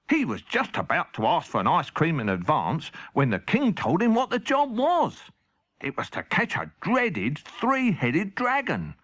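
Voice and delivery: ordinary delivery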